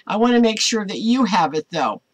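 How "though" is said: ordinary delivery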